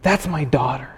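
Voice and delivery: put-on God speaking softly to His child; tender father. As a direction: speak it like a proud father leaning close. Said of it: "God looks at you and says".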